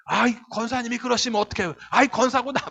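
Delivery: exasperated, high-pitched complaint; indignant scolding. Acted. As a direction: mimic a fussy reproach. Acted church member scolding an elder.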